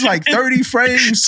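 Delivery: ordinary talk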